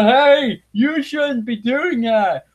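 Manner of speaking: high, slow sneering mock voice. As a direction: sneer it out as a cartoon bigot.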